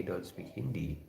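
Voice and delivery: ordinary delivery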